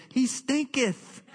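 slow, high, drawn-out archaic proclamation; mock King James. Preacher quoting King James on Lazarus, hammed-up and slow. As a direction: stretch it out like old scripture. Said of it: "the King James says".

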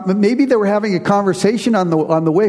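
normal talking voice

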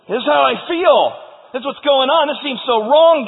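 high, loud protest to God; bold complaint. Acted. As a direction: cry out your grievance to heaven. Acting out Elijah's bold complaint to God.

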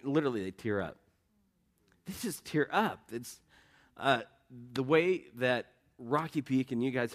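real emotion breaking through, softly; getting emotional. Real, not acted. Pastor thanking the church, choked up with emotion. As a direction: voice catching, quiet and halting. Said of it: "I'm probably getting a little emotional".

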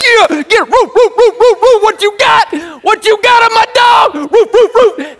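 high, loud frenzied shouting and barking; going crazy. Retold or imitated. Man dancing round the yard with his dog, going crazy. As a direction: wildly hype up a dog, woofing between yells. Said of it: "Like I'm going crazy".

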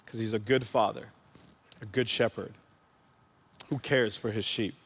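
low, hushed sincerity; tender reassurance. Real, not acted. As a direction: reassure them softly and low, with pauses. Preacher reassures listeners about God's care.

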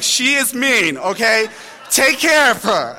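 loud, high mock outrage; retold exasperated complaint. Dad calling the preschool teacher over, loud mock outrage. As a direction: call it out, hammed-up and indignant.

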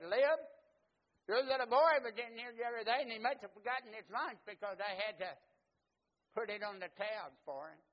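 loud, high friendly shopkeeper; storekeeper telling dad. Retold or imitated. Storekeeper telling the boy's dad about the tab, loud and high. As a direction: call out to the dad cheerfully.

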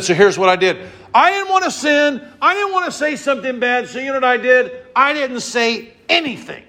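voicing David's resolve to stay silent; David's vow. Preacher acting out David's vow of silence.

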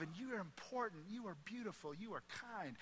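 a hushed, loving nanny; tender nanny voice. Retold or imitated. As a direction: hush down and tell her she matters. Nanny telling the child her worth.

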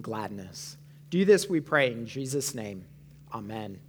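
low-pitched, measured prayer voice; public prayer. Real, not acted. Pastor asking God to open eyes and soften hearts, reverent prayer.

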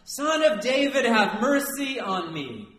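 high, loud, drawn-out yell. Acted. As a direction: holler the plea out, stretching the last words. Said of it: "he just yells even louder".

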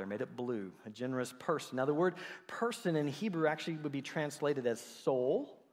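ordinary talk, nothing marked

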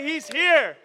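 loud, high, falling on here; echoed proclamation. Shown as a demonstration. Preacher modelling the response for the congregation, loud. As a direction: call it back like the crowd should. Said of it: "Somebody say".

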